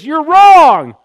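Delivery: loud, high, red-faced insistence; emphatic denial. Retold or imitated. Peter telling Jesus he's wrong, emphatic shout. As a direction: fire it back at Jesus. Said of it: "He's emphatically making the statement".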